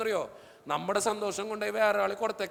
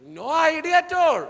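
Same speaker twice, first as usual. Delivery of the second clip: very slow and loud, higher; slow emphatic punchline; 5 semitones higher, 8 dB louder, much slower than usual. Real, not acted. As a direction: stretch every word out as a punchline. Kid cannot say why crying.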